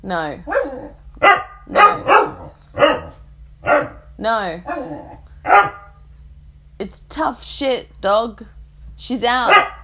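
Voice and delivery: loud, stern refusals at the dog; stern dominatrix tone. Real, not acted. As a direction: lay down the law with the dog. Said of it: "dominatrix talk might like this".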